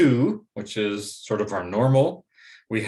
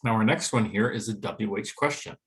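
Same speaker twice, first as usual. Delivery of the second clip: ordinary delivery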